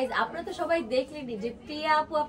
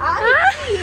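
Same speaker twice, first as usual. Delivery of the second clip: loud protest; 12 semitones higher, 12 dB louder, much slower than usual. Real, not acted. Playful fight over the bill.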